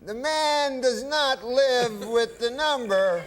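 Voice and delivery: high, very slow Cosby drawl; Bill Cosby impression. Guest doing Cosby for the host, high and slow. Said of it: "And he said".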